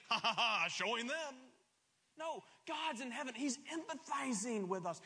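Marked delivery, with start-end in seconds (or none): 0.1-1.3 mock gloating laugh, louder; hammed-up villain cackling at people's pain; mock gloating laugh of a cruel god. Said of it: "God is not in heaven saying".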